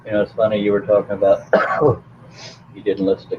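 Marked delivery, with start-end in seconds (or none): 1.4-2.0 a short dry cough. Real, not acted. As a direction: cough once, away from the mic. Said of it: "Excuse me while I choke".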